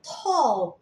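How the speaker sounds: "Tall" with a long, rounded O, like "tol"; correct O sound. Shown as a demonstration. Teacher corrects a common misreading, "Tall" voiced with O. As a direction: model "Tall" with an O vowel. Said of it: "Itu sebenarnya suara O".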